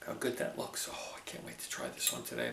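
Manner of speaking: hushed whisper over the plate. Real, not acted. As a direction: whisper it, savoring.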